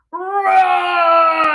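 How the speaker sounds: loud roaring shout; big playful monster roar. Acted. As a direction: roar to scare something big.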